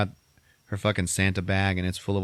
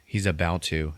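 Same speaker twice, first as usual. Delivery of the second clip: ordinary talk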